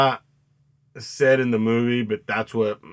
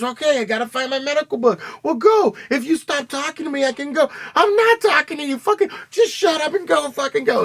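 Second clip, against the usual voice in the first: high, loud, frantic back-and-forth bickering; two panicked men snapping at each other; mock panicked quarrel; 4 dB louder than usual. Acted argument between two characters.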